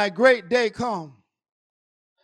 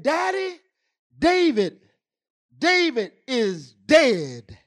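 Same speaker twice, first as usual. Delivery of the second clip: lightly voiced daughter breaking bad news; drawn-out retelling of terrible news; imitated grieving daughter; 3 semitones higher, 3 dB louder, slower than usual. Retells daughter's call of death.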